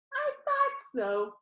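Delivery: perky showman pitching to his audience; cheerful Danny. Acted. Danny on video teasing the funeral crowd, bright and upbeat.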